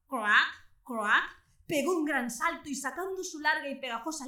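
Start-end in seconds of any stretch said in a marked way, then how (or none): 0.1-1.2 frog croak. Acted. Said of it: "mientras gritaba".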